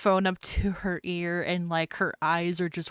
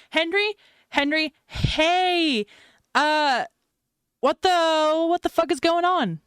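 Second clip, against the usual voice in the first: high, loud frantic demand; rising panic, pitched way up; panicked; 10 semitones higher, 7 dB louder than usual. Acted. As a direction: shout down the phone, desperate for an answer. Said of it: "Mad panics".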